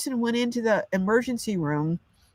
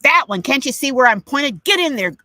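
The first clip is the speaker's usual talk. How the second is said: higher and louder, an impatient snap at the patient; rude bossy doctor; 6 semitones higher, 7 dB louder than usual. Retold or imitated. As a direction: bark the order like an irritated doctor. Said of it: "That's the tone".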